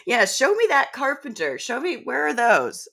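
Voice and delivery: teasing high-pitched sarcasm; sarcastic challenge. Retold or imitated. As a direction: dare her to produce the carpenter. Mocking the homesteading advice.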